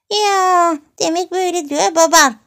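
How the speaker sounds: very high, loud mimicry; mocking granny imitation. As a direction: parrot the old woman to tease her. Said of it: "koca ninenin taklidini yaptı". (koca nineyi tiz sesle alaycı taklit)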